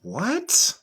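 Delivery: soft, mock-baffled rising "what"; quietly stunned, as if doubting your ears; acted disbelief. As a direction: pretend you can't believe it. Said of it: "You gonna be looking like".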